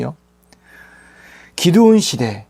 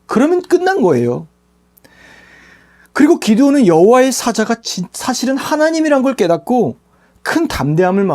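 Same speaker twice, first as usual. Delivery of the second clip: ordinary delivery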